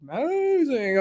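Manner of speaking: light impression of Pascal. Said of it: "Pascal's like".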